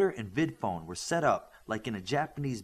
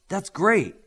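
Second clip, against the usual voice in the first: delighted; 8 semitones higher, 9 dB louder than usual. Acted. Delighted reply.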